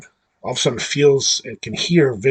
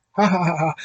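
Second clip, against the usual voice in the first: mock laugh, pitched up; imitated laugh; 7 semitones higher, faster than usual. Recapping how she laughs and drives off, imitated laugh. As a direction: spell out a high put-on "ha ha".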